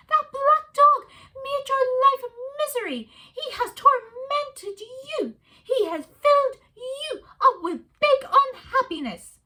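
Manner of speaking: high, loud meerkat near tears; tearful meerkat. A narrator's reading. Meerkat Kevin tearful over his wife's misery. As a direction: plead with a catch in the voice.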